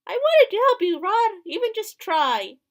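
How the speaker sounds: high, loud desperate plea; pleading outburst. Acted. Character pleading with Rod.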